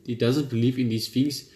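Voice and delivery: ordinary delivery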